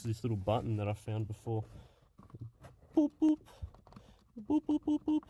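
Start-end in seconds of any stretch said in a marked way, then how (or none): 3.0-5.2 high, loud imitated beeps; beeping noises. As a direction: squeak out little button beeps. Mimicking the button's beeps.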